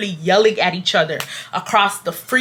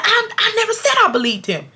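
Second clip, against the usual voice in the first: high-pitched mock denial; squeaky retold self-defense; defensive protest; 12 semitones higher than usual. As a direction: imitate someone backpedaling indignantly. Manager defending himself in the quarrel.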